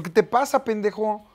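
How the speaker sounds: mock furious retort; retold angry scold. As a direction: tell off the rude teenager. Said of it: "Le digo".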